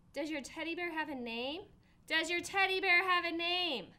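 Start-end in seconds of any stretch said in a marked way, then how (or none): 2.1-3.9 louder and higher, repeating the question; raised voice for a friend who didn't hear. Acted. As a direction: say it again so he hears. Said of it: "I said louder".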